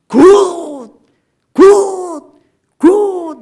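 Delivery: high, loud, drawn-out cries of approval; exclaiming. Acted. Preacher voices God admiring the new creation, exclaiming loudly. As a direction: proclaim each word with huge delight.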